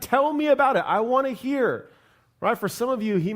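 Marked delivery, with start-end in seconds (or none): 0.0-1.9 higher and louder, eager and delighted; light up like you can't wait to hear the news; excited interest. Acted. God asks someone newly in love about it, excited. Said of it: "You're super excited".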